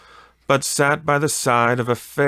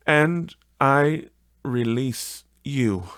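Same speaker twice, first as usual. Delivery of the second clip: put-on higher, a gently grieving young woman; sorrowful young woman; 6 semitones higher, 3 dB louder, slower than usual. A fiancée releasing Scrooge, higher and grieving. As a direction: let her sorrow show, slow at the end.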